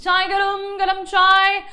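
tea hawker's cry, high and loud; imitating a railway tea seller calling out; high-pitched vendor call. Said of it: "a vendor called out in a high-pitched voice".

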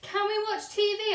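put-on a little boy pleading for TV; child asking. A narrator's reading. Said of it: "asked Lester".

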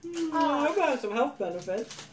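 talking between bites of candy; chewing while speaking; mouth full, chewing. Real, not acted. Seen 0: Woman ate a piece just before; she keeps chewing while speaking.

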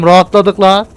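ordinary talk

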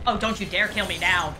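ordinary delivery

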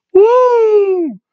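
very high, long "woo"; drawn-out falsetto whoop; trademark wrestler's whoop. Retold or imitated. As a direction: let out a piercing high whoop.

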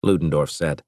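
usual speaking voice

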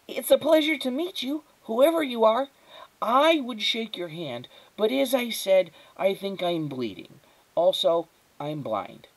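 put-on formal little boy, pitched high; precocious child, dropping at the end; high, thin boy's voice. A narrator's reading. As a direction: make a polite, self-important introduction. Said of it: "His voice was high and thin".

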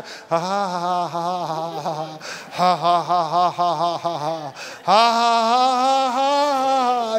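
deliberate, drawn-out "ha ha ha"; forced laugh. Shown as a demonstration. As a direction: make yourself laugh in steady mechanical beats. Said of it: "you're just gonna have to".

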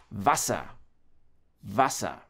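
quick A before double S in Wasser; short A in Wasser. Shown as a demonstration. German teacher showing double consonants to learners, short A in Wasser. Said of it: "make the sound shorter, faster".

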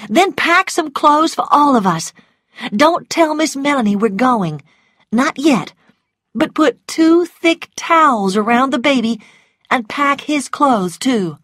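high-voiced woman giving orders; pressing, high-pitched commands; urgent mistress.

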